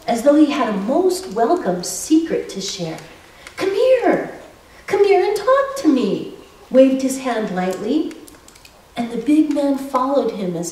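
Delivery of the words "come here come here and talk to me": put-on high, cheerful old man's call; an old man warmly calling a stranger over; friendly old man. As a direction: beckon him over, light and inviting.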